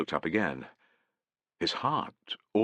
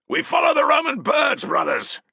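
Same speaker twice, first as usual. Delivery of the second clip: high, loud rallying shout; 6 dB louder than usual. Acted. Centurion rallying his men to advance, high and loud. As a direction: rouse them to charge. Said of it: "shouted Tallis".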